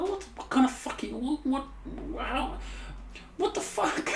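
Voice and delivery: climbing squeaky what the fuck; baffled disbelief. Real, not acted. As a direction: stammer out your confusion way up high. Reacting to the smiling posters.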